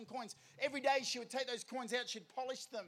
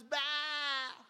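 long, higher imitated bleat; sheep bleat; 4 semitones higher than usual. Stuck sheep looks up at the shepherd, bleating. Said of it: "Johnny looks up and goes".

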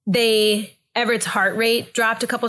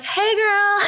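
high, sing-song chirpy greeting; over-cheery high-pitched greeting; fake casual; 12 semitones higher than usual. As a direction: cover the pain with a bright, high hello. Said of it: "I don't like people to know when I'm, like, in pain".

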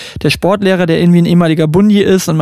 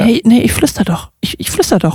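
failed whisper, higher and still voiced; imitierte Mutter; 6 semitones higher than usual. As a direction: mimic a mother who thinks she is whispering. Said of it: "die kann nicht flüstern". (misslungenes Flüstern der Mutter, nachgemacht)

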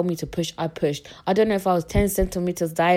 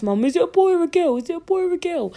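curious onlookers; 11 semitones higher, 3 dB louder than usual. Retold or imitated. Onlookers calling out from street.

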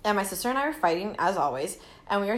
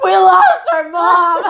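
loud, slow, high mock wail; crying sister; 8 semitones higher, 12 dB louder, much slower than usual. Crying sister to store lady, mock wailing shout. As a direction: bawl it like an upset little kid. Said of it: "and my sister crying".

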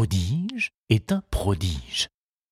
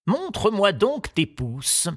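gardener's voice; 11 semitones higher, slower than usual. Acted. Gardener examines boy's thumbs.

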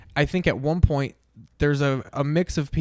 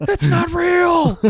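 much higher, louder, exasperated yell; upset yell; 5 dB louder, much slower than usual. Retold or imitated. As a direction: snap at the audience. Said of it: "you were just so upset".

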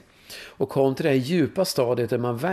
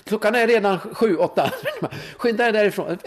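higher, hurried nagging with a laugh; mock-urgent inner voice, much higher, breaking into a laugh; 9 semitones higher than usual. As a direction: play the mind hustling him off to the pub, half laughing. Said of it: "sen sa mitt mind".

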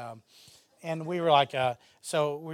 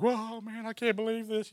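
amazed agent; 8 semitones higher than usual. Retold or imitated.

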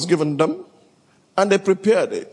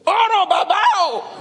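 mock cry of pain; 13 semitones higher than usual. Sick elder crying out at the injection, high comic yelp. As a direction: yelp like the injection just hit. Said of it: "The God inside him cried out".

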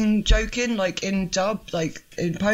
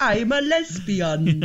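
much higher, very slow, hammed-up northern English accent; fake northern English accent; 3 semitones higher, much slower than usual. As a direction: ham up a bad northern English accent, drawn out. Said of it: "the northern woman going".

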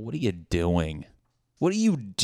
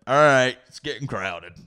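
bouncer; 5 semitones higher, 6 dB louder than usual. Pretend. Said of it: "had to be the bouncer".

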